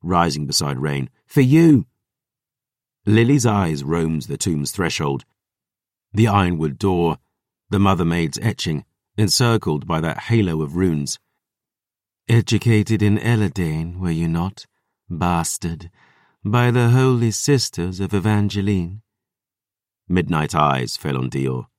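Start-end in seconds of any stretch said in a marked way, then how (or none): 1.2-1.9 loud, high fierce threat; fierce vow. Acted. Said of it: "Dior vowed". 12.3-19.0 cold, mocking predator; menacing vampire voice. Lily questions mockingly.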